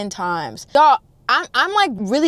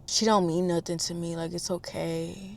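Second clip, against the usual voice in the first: soft, hushed reassurance; soothing; 3 semitones lower, 10 dB quieter than usual. Retold or imitated. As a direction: imitate a man gently calming her down. Retells him soothing Lauren.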